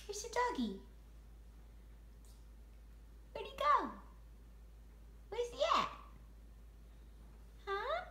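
squeaky high playful asking. Real, not acted. High playful questions to baby.

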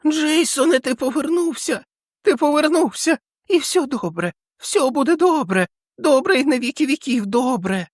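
put-on very high, joyful old man's voice; overjoyed, voice soaring high; ecstatic old man. Old man welcoming Jason back, very high joyful.

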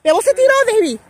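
high excited call. Real, not acted. Calling out to David.

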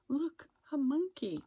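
high, excited kitten exclamation; excited cry. A narrator's reading. Kitten spots a monkey at the zoo, excited cry. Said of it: "cried Kitten".

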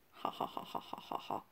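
breathy unvoiced ha ha ha; a hushed sneaky laugh; soft whispered chuckle. Mock evil laugh in game.

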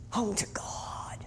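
slow, very soft whisper. Real, not acted. Preacher echoing the final words, slow whisper. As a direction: breathe it out as a slow echo.

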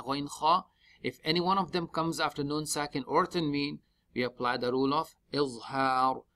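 ordinary talk

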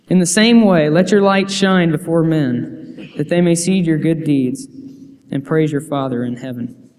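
ordinary talk, nothing marked

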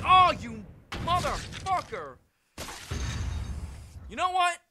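drawn-out furious shout; furious yell. Real, not acted. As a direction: scream it out slowly in rage. Furious at game obstacle.

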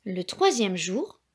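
plain reading voice

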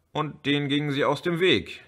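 ordinary delivery

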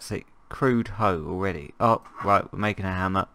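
usual speaking voice

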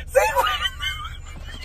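very high, slow squealing yell; ecstatic shriek. Real, not acted. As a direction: scream with joy. Big scratcher win.

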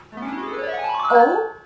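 ordinary delivery

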